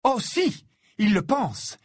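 high, angry contradiction; heated exclamation. A narrator's reading. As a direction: flare up and contradict him. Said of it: "s'exclama Ginny en s'emportant".

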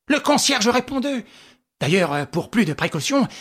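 plain reading voice

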